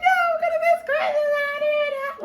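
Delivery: high, loud sobbing wail; crying outburst. Retold or imitated. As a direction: wail like a kid losing Christmas. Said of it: "I'm like".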